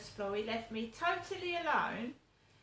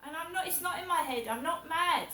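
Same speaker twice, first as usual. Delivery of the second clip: raised, higher voice, defending yourself; indignant insistence; 4 semitones higher, 6 dB louder than usual. Real, not acted. Insisting to viewers it's not in their head, indignant. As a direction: insist hotly that you saw it, quick at first.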